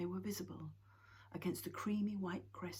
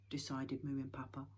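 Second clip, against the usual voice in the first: ordinary delivery, nothing marked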